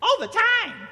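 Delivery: high loud squawk of all the time; Randy's catchphrase. Retold or imitated. Mocking the catchphrase back at the host, high and loud. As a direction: squawk it like a parrot. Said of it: "there's a bit where he goes".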